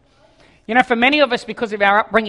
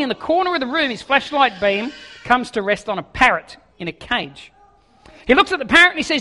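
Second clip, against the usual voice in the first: normal talking voice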